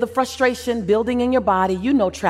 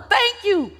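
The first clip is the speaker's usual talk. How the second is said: yelled thanks; 10 semitones higher than usual. Pretend. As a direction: shout it up to the stage. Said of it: "Row one".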